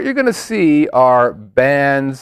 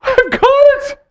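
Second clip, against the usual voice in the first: excited realization; 3 dB louder than usual. Real, not acted. Sudden realization, shouted high.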